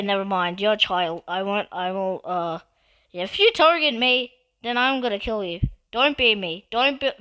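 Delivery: ordinary talk, nothing marked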